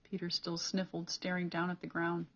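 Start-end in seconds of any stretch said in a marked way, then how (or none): none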